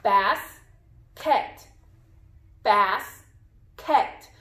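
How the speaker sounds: "Basket" as bas ... ket, long pause between; split into syllables. Shown as a demonstration. Teacher has pupils count syllables, "Basket" pulled apart as bas, ket. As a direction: break "Basket" in two, each syllable fully voiced. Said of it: "Where should we split the word".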